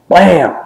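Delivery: single high mock gun blast; high, falling gunshot noise; imitated gunshot. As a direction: fire one comic-book shot with the voice. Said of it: "takes one shot in the air".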